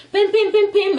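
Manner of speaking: high, repeated horn beeps; car horn honking. Retold or imitated. Storyteller imitates a car honking behind the couple, high beeps. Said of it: "they started honking".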